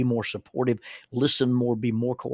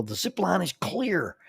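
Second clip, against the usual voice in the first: mock yell, stretched out and raised; imitated yell; 7 semitones higher than usual. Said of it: "the people on the ground would yell".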